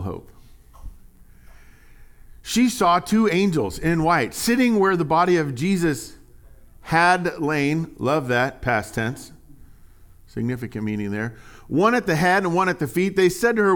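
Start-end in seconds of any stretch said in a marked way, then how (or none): 2.4-7.9 raised, measured Bible reading; lifted reading voice; scripture reading. Acted. Reads scripture aloud. 11.7-13.8 raised, measured Bible reading; lifted reading voice; scripture reading. Acted. Reads scripture aloud.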